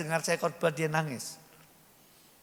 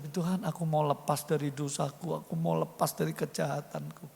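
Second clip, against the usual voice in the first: humble prayer; 6 semitones lower, 6 dB quieter than usual. Retold or imitated. Acted prayer of surrender.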